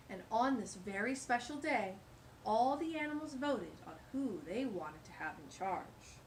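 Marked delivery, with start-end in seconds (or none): none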